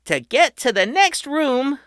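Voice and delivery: put-on high, loud tour-guide kid; proud boy host. A narrator's reading. Patrick welcoming his family underground, high and proud. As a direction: announce it like a grand showman. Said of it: "Patrick explained".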